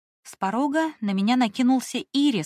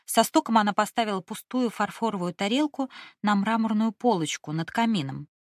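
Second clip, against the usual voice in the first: ordinary delivery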